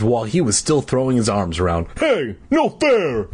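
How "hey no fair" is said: slow put-on Moose voice; new silly voice. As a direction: drag it out in a goofy voice. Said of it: "he declared in his new voice".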